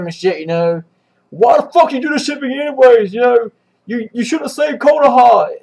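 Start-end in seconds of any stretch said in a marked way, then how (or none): 1.3-5.5 imitating a furious teen, pitched up; hammed-up angry yelling at his brother; half-serious emo outburst, higher and pushed; mock emo rage. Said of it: "all fucking emo rage, just yelling at him".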